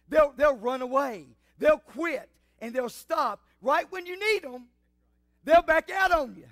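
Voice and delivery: high, loud pulpit delivery; forceful preaching. Real, not acted. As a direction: drive each phrase home like a revival preacher.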